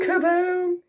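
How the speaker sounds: high kaboom; explosive boom. A narrator's reading. Reader sounding another hit, explosive. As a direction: pop it out like a blast.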